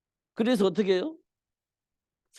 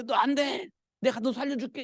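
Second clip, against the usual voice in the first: high, insistent protest; acted desperate pleading; 6 semitones higher than usual. Preacher plays the king promising Daniel rescue, pleading. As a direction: plead as the king trying to save him.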